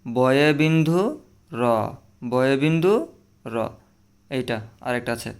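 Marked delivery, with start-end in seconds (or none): 0.1-3.6 বয়ে বিন্দু র said twice, clearly; বয়ে বিন্দু র, carefully; correct pronunciation. Shown as a demonstration. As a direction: model বয়ে বিন্দু র for the class. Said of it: "এটার সঠিক উচ্চারণ হবে কি?".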